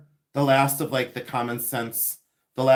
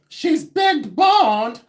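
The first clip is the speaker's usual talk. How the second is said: high, loud, slow comic punchline; imitating Judy Tenuta; 9 semitones higher, 6 dB louder, much slower than usual. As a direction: stretch every word, pitched up and loud. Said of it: "and then she'd go".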